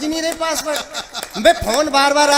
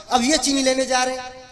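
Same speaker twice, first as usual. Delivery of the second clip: ordinary delivery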